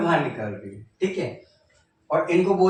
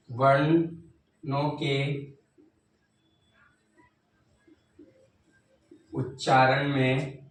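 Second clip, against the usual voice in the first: very slow, word by word with long pauses; drawn-out classroom dictation; much slower than usual. Shown as a demonstration. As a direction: spell out जिन वर्णों के उच्चारण में slowly for pupils to write. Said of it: "बोलिए मेरे साथ".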